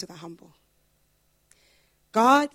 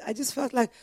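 ordinary delivery

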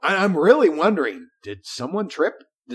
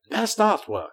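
lightly put-on, higher narrator voice; Mark Silk; 6 semitones higher, 4 dB quieter than usual. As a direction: mimic an awards-show narrator. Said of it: "That's my Mark Silk impression".

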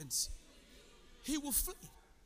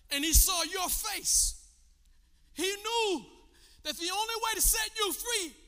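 high, loud, drawn-out preaching cry; impassioned preaching; 9 semitones higher, 8 dB louder, slower than usual. Real, not acted. Preacher tells church of Christ's suffering, crying out passionately. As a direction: cry out each phrase with long pauses between. Preaches the crucifixion at full force.

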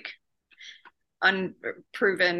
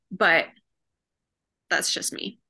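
usual speaking voice

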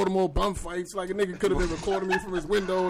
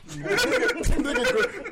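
usual speaking voice

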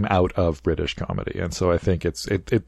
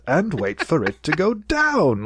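higher, slow, pompous put-on dad; mock pompous dad; 8 semitones higher, slower than usual. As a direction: drag it out like a fussy British father. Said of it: "And then the dad says".

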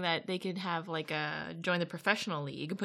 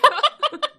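burst of laughter; 17 dB louder than usual. Real, not acted. Laughing at her own Russian story.

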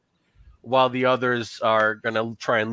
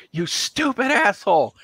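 high put-on cat voice, scornful; cat snapping; 8 semitones higher than usual. Talking cat insulting the clumsy player, high and scornful. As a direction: sneer it as a rude talking cat. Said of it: "He goes".

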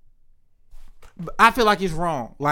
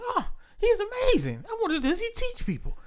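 much higher, softer gushing voice with a laugh; mock gushing; 6 dB quieter than usual. Mocking his own reaction to the story, high and gushing. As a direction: gush like a starstruck fan.

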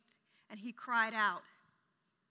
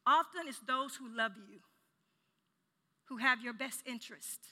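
ordinary delivery

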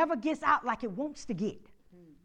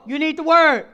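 loud, higher lead line for the church to repeat; call and response; 6 semitones higher, 17 dB louder than usual. Shown as a demonstration. Pastor leading the congregation in a loud chant. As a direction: call it out for them to echo.

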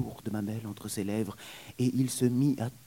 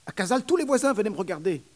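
fast, higher nurse chatter; 11 semitones higher, 6 dB louder, much faster than usual. Acted. Nurse telling the young man, fast and higher. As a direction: rattle it off proudly.

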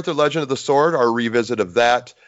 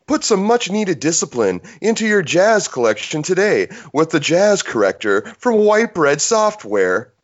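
ad read; 6 semitones higher than usual. Acted. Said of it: "do the last paragraph over".

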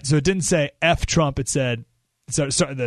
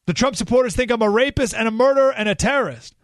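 higher-pitched mock indignation; indignant protest; 6 semitones higher than usual. Protesters answering the call to love, mildly indignant. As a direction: voice an aggrieved objector. Acts out angry protesters' objection.

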